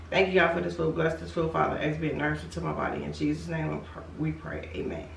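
soft, low, fast mealtime prayer; quiet grace. Real, not acted. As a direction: murmur grace quickly over food. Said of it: "I'm gonna say my grace".

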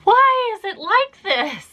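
high, slow, comic whine; whiny puzzlement. Real, not acted. Puzzled by her drooling.

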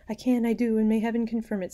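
high, loud fervent voice; dying daughter. A narrator's reading. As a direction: grant forgiveness with fervour. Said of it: "said Matilda".

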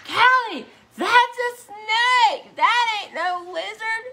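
high, loud, slow alarmed mom; mom's alarmed voice. Retold or imitated. Mom finding the pet snake outside, high and loud. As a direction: sound the alarm like a startled mother. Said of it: "she's like".

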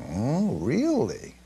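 slow, drawn-out feigned amazement; mock surprise. Preacher feigning surprise at a verse, slow and drawn out. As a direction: play at being newly struck.

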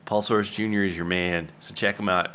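ordinary talk, nothing marked

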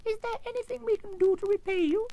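very high little royal squeak; squeaky mouse queen. A narrator's reading. Mouse queen explaining her rescue, squeaky. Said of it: "in a squeaky little voice".

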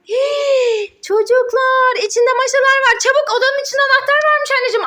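high, fast playful alarm; mock alarm. Mother staging a rescue game for her son, mock alarmed. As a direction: gasp and hurry like it's an emergency. Mom dramatizes rescue mission.

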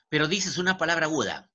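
usual speaking voice

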